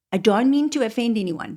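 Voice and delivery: normal talking voice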